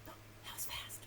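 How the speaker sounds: usual speaking voice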